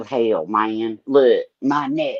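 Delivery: usual speaking voice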